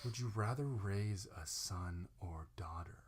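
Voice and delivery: slow, breathy whisper; soft, drawn-out dramatic whisper; hushed reading. Pretend. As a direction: whisper the question with mock gravity. Hushed dramatic last question.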